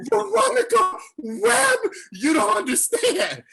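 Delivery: high, slow, near-crying squeal; starstruck squeal. Retold or imitated. Fan squealing the name of his idol.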